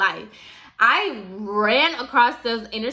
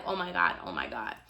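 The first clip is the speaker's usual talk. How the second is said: nervous; 6 semitones lower, 9 dB quieter, faster than usual. Retold or imitated. Nervous speed walking in the dark.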